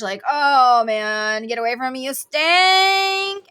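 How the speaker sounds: loud groan building to a high cry; hammed-up revulsion, last word drawn out high; mock disgust. Energy telling a smelly person to leave, loud mock disgust. Acts out repelling a stinker.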